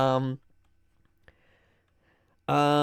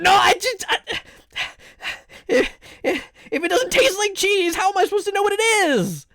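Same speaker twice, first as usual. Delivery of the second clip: exasperated whine; 12 semitones higher than usual. Real, not acted. Exasperated defence of her cheese confusion.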